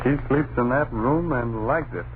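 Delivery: usual speaking voice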